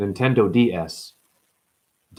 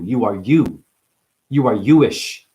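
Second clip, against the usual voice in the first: ordinary delivery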